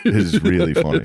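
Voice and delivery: high-pitched laugh through the words; cracking up as you say it. Real, not acted. Laughing at guest's view.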